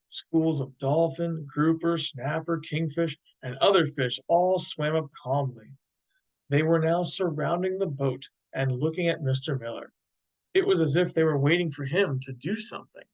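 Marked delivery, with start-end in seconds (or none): none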